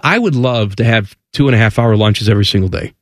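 usual speaking voice

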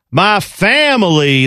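drawn-out Southern drawl, extra syllable in family; mock Southern accent, stretch it to "fam-uh-lee"; Southern twang. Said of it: "He added the extra syllable".

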